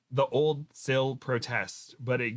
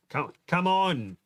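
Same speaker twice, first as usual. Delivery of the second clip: slow, drawn-out call, higher pitched; mild yell; 6 semitones higher, slower than usual. A narrator's reading. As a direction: urge them on with a stretched-out narrated yell. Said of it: "Tom yells".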